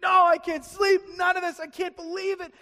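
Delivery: high, shouted mock panic; flipping out. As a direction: wail it loudly like a panicking king. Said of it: "he's flipping out".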